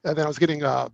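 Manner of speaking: normal talking voice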